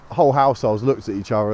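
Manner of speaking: usual speaking voice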